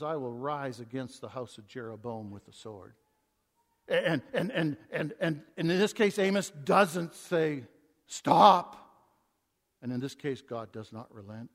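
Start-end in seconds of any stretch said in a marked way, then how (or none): 0.0-2.9 low, soft pronouncement. Acted. God's judgement quoted. 8.1-8.8 a loud sudden stop; sudden loud cry. Pretend. The preacher voicing the plea Amos never made, a loud sudden cry.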